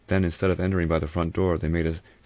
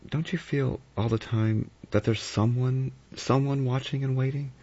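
Vera, uneasy; 9 semitones higher than usual. Acted. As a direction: let the sense of being watched creep in.

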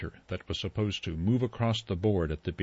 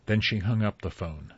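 ordinary delivery, nothing marked

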